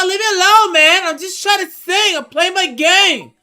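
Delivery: high, loud whining protest; mock kid's yelling. Singing kid defending himself to a heckler, mock high-pitched yelling. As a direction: shout it high like an upset kid.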